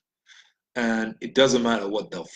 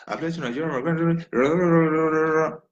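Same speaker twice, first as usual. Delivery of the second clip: felt pledge; 5 semitones higher than usual. Shown as a demonstration. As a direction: feel every word of the oath. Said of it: "You have to feel it".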